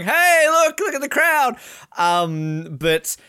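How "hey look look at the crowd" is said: very high, loud mock cheer; calling out excitedly. Imitating athletes filming with their phones, excited calling out. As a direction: holler it like a thrilled tourist. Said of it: "coming out with their phones going".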